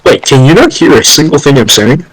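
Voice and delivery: normal talking voice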